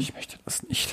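breathy whisper, soft and far back; whispered refusal. Pretend. Running podcast gag, a whispered mock refusal.

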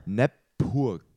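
"Nepurk" read as a reversed word, nɛpuk; backwards speech. Shown as a demonstration. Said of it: "Mit dem Spiel Wörter rückwärts". (rückwärts gesprochen: "Nepurk")

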